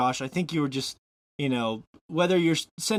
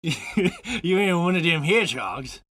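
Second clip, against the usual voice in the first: slow, loosely imitated Southern accent; mock Southern accent; 3 semitones higher, 4 dB louder, slower than usual. Host plays along with Josh's hedgehog joke, mock Southern accent. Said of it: "talking about a bad Southern accent".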